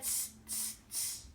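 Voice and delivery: soft, voiceless "tss" of mimicking monkeys; monkey tsking. A narrator's reading. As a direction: tsk back teasingly like monkeys. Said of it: "and said".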